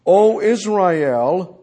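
loud, high preaching challenge; forceful preaching. Real, not acted. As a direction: preach it hard, pressing the congregation.

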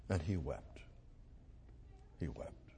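sinking to a whisper; hushed. Real, not acted. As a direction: let it fade to a breathless whisper. Preacher lingers on Jesus weeping.

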